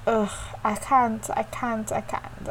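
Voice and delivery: slow exhausted groan, fading to breath; too tired. Retold or imitated. Worn-out teacher refusing to play, fading to a breath. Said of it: "I'm tired".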